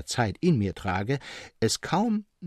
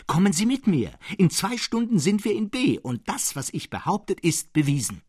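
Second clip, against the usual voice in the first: higher, louder, eager urging; excited urging; 8 semitones higher, 3 dB louder than usual. Retold or imitated. Narrator urges Serapion to walk to B, eager and loud. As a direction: press him triumphantly to come along. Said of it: "rief ich".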